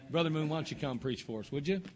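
usual speaking voice

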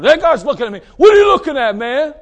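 hot-tempered guy. Acted. Hothead turning round on a stranger, acted angry shout. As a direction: play a hothead picking a fight. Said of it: "who's always losing his temper".

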